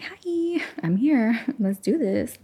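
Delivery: a giddy high hello that drops to a whispered let's go; playful hello to the mic. Pretend. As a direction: greet the microphone like an old friend, squeaky-high, then hush it. Greeting her microphone playfully.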